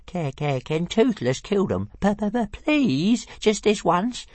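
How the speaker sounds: high stuttering plea, slowing; squeaky stammered please; begging dragon. Acted. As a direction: wheedle for a treat. Dragon begs to kill fish.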